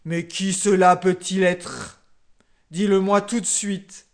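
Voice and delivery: a loud lion's roar of a demand. A narrator's reading. The furious lion king demands an answer, loudly roaring. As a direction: bellow like an angry king. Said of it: "et rugit".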